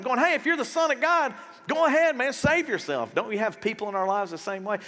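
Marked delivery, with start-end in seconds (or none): 0.2-3.1 mocking jeer. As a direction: jeer at him, daring him to prove it. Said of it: "was mocking him, making fun of him".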